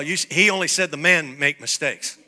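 ordinary talk

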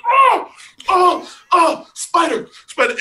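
high, loud panicked yelp at a spider web; loud, high scream of spider fear; panicked shriek. Retold or imitated. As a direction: shriek it like you just walked into a web. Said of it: "I just flew".

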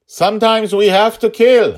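high, loud insistence; shouted. A narrator's reading. Father insists to his daughter she must kill, loud shout. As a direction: shout him down. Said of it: "he shouted".

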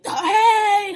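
a high, loud, bubbly "hey"; imitated excited greeting. Imitating girls greeting Chelsea with a loud excited hey. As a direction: mimic the girls gushing hello.

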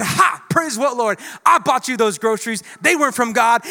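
loud, high mocking jeer; gloating neighbor. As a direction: gloat triumphantly. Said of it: "atheist neighbor that said".

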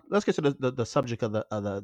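usual speaking voice

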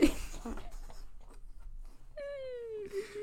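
a high, wheezing laugh; high breathless laugh. Real, not acted. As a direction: laugh till you can barely breathe. Laughing at her own imitation.

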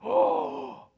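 loud, very high acted gasp; awestruck gasp. Disciples as God opens their eyes, loud gasp. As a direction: gasp as if suddenly seeing.

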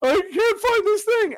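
high, slow panicked stage actor; actor freaking out. Retold or imitated. Actor on stage panicking over a lost prop, high and slow. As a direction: ham up a frantic actor who lost his prop. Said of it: "freaking out as the character".

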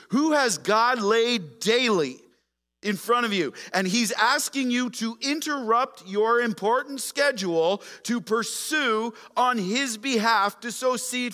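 loud, high, slow pulpit cadence; full-force preaching. Acted. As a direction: preach it to the whole room.

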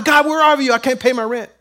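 high, loud cry up to God; panicked plea. Acted. Believer short on rent calling out to God, panicked plea.

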